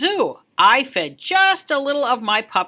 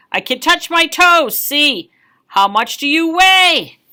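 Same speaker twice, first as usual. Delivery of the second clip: put-on higher, louder child voice; chatty kid; 5 semitones higher, 7 dB louder than usual. A child blurting at story time, eager and loud.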